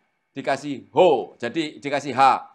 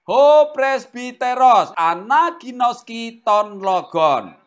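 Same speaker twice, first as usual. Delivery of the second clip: slow and louder, each Greek word held; deliberate teacher's reading of a Greek sentence; slow model reading; 4 semitones higher, 5 dB louder, much slower than usual. Shown as a demonstration. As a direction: read it out carefully for the class to copy. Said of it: "reading Greek sentence to class".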